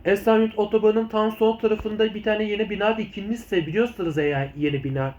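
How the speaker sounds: normal talking voice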